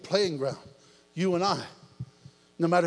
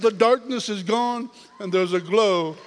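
mama's slow answer; 4 semitones higher, 6 dB louder, slower than usual. Retold or imitated. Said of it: "She said".

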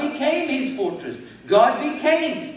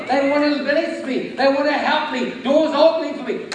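loud and rising, full of excitement; joyful declaration; 3 semitones higher, 6 dB louder than usual. Shown as a demonstration. Preacher modelling a morning declaration, loud and excited. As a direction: shout out a blessed day. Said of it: "you should declare".

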